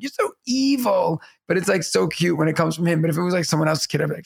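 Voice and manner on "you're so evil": higher, slow imitation of a little kid; kindergarten son. Son being chased and tickled by Dad, imitated kid. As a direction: mimic a child mid tickle game. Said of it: "he's like".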